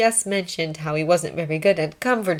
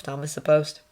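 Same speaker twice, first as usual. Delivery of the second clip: ordinary delivery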